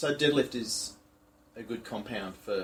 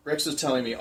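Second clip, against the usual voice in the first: normal talking voice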